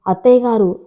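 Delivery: usual speaking voice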